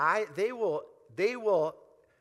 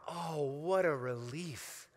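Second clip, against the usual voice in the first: relieved sigh; 4 semitones lower, slower than usual. Acted. Relief after confession.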